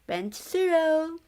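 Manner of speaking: high, loud and slow pitch; ghost salesman. A narrator's reading. Ghost pitching the dorm to Yuya, high and slow. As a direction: sell it like an estate agent. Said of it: "one of the ghosts said".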